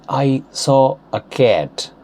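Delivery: slow, careful American-accented "cat" with open vowel. Shown as a demonstration. Teacher giving an example sentence, slow American accent. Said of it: "Changing them into Indian accent".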